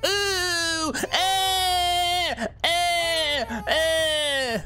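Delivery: high shrieking yelps, one after another; panicked screams at a jump scare; startled shrieks. Real, not acted.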